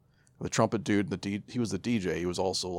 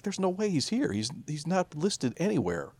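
incredulous; 9 semitones higher than usual. Retold or imitated. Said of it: "So that's why I was like".

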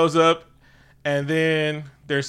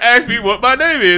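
higher, louder taunt; mock challenger's taunt; taunting demand; 8 semitones higher, 8 dB louder than usual. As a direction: dare them to ask. Killmonger demanding, retold.

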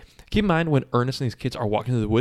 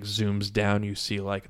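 ordinary talk

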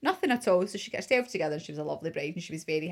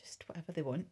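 whisper; 8 dB quieter than usual. Real, not acted.

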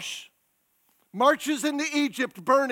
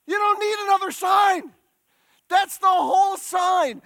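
higher and louder, slowing on the whole sign; preaching shout; 7 semitones higher, 6 dB louder than usual. Real, not acted. Preacher to congregation, shouting about the sign. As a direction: drive the point home at full force.

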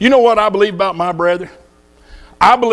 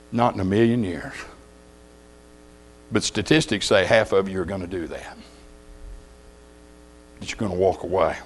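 much lower and softer, grave; hushed; 10 semitones lower, 9 dB quieter than usual. Real, not acted. Preacher warns his flock that half will leave, low and hushed. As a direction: drop it low and quiet, let it sink in. Sober warning to the congregation.

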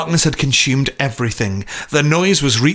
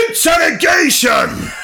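high, drawn-out theatrical shout; hammed-up inspector's yell; slower than usual. A narrator's reading. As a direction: bellow it like a big announcement. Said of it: "He shouted".

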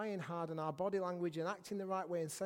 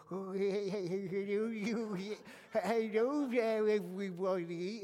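slow, drawn-out pink hippo puppet voice; George; 3 dB louder than usual. Acted. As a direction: stretch out a wobbly greeting as a puppet hippo. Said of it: "for the pink hippopotamus, you have to go".

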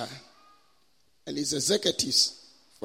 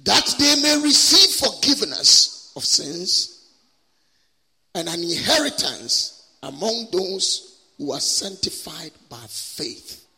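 scripture proclamation; 5 semitones higher, 4 dB louder than usual. Acted. Preacher reads scripture to the congregation, solemn proclamation. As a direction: declaim it phrase by phrase, pausing between.

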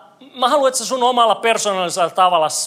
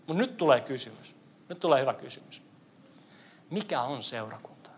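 hushed build-up; 4 semitones lower, 11 dB quieter than usual. Acted.

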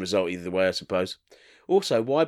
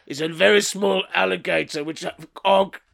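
higher, deliberate caveman voice; 5 semitones higher than usual. Acted. Caveman sketch continues.

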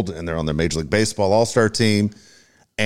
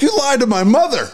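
laughing mock yell, pitched way up; retold scream; 4 dB louder than usual. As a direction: yell it like a betrayed ballplayer, half laughing. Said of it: "he's screaming at him nonstop".

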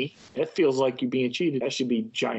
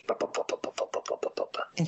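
engine noise; 6 dB quieter, faster than usual. Pretend. Mimicking little lawnmower cars.

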